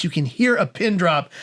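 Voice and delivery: ordinary talk, nothing marked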